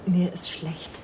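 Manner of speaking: faint, whispered nausea; weak whisper. Acted. Beaten hostage feeling sick, weak whisper. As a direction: barely breathe it.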